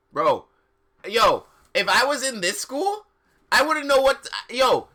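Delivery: blurting it out in delight; excited. Real, not acted. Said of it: "excited outburst at the idea".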